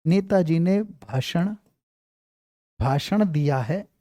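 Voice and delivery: slow, drawn-out words with long pauses; slow dictation. Shown as a demonstration. Teacher dictating to students so they can write it, slowly. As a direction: spell out Netaji ने भाषण for writing, very slowly. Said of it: "कई बार आएगा".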